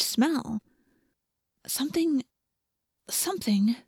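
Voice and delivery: soft, high, wondering narration; hushed puzzlement. A narrator's reading. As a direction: feel out a faint clue, haltingly. Narrator puzzles over a faint strange smell.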